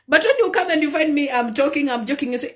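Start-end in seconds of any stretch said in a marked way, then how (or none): none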